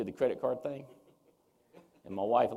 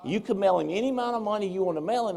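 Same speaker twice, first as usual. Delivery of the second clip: normal talking voice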